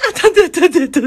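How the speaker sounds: mock buyer backpedalling in a rush; panicked scammer. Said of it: "Et là, il me dit".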